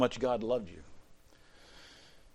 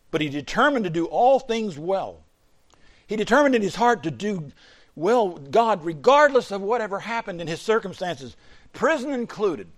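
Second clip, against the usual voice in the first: rising, louder preacher's build; preaching; 7 semitones higher, 6 dB louder than usual. Acted. Preacher building to the congregation, raised and louder. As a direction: drive the point home to the congregation.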